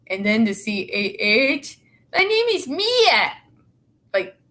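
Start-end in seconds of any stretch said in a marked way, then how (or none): 2.1-3.3 high mock British accent; mock her bad British accent. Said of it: "that's supposed to be British".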